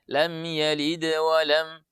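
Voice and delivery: slow recitation breaking off after "lam"; stopping wrongly. Shown as a demonstration. Teacher showing the wrong, broken reading. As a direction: stop short on "wa lam" without joining. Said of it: "kalau tidak dibaca".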